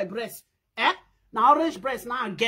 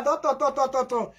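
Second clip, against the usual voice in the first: normal talking voice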